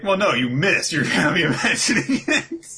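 teasing, voice lifted and laughing. Retold or imitated. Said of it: "Squami's like".

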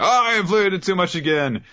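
very high whiny voice; flustered Chrome. Retold or imitated. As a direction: whine at your own mistake. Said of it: "It's like".

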